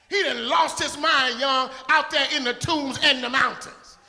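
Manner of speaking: loud rising sermon cadence; full-force preaching. Real, not acted. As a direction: preach it out to the congregation.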